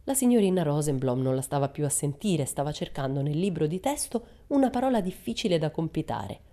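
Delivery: ordinary delivery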